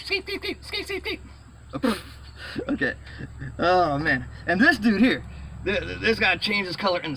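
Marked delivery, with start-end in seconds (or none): 0.0-1.2 high, softer chanted sound effect; squeaky repeated toy noise; mock sound effect.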